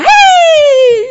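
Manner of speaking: loud high cheerful hey; big happy hello across the room; excited greeting. Retold or imitated. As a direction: greet them like a friend walking in. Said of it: "Or they're going".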